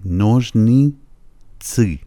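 slow نوژنیتسی, plain first i, thick final i; two different i sounds. Shown as a demonstration. Teacher showing learners the two i's in نوژنیتسی, drawn out slowly. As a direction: draw out نوژنیتسی, thickening the last i. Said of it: "ای اول ای ساده است، ای آخرش یه غلیظه".

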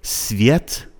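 "سویت" as svyet, no t before s; plain s start. Shown as a demonstration. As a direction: open "سویت" on a simple s.

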